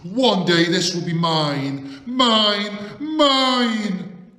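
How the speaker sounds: building to a furious shout; incanting. Acted. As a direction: chant it, rising to a loud roar. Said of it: "she would incant to herself".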